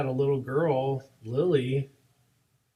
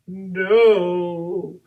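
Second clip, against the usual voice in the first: high, loud mock wail; imitated crying child; 5 semitones higher, 9 dB louder than usual. Little girl answering why she's crying, mock wail. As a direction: wail it like a weepy little kid. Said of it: "and she's crying".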